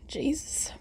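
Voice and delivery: breathy whisper. Real, not acted. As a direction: whisper it on a sigh. Relieved after the burp.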